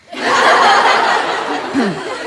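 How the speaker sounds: breathy laugh at own joke; a real unvoiced chuckle; cracking up at the punchline. Real, not acted.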